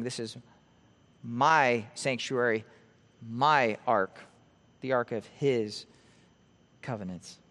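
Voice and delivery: low, slow, with "my" and "his" each weighted and set off by pauses; emphatic possessives. Acted. Preacher voices God claiming the ark, low stress on "my" and "his". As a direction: lean on each "my", then on "his", dropping away on "covenants". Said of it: "he's emphasizing".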